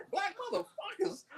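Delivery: high, hushed disbelieving repeat; incredulous echo. Retold or imitated. Man stunned by girlfriend's words, hushed and high. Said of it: "I said".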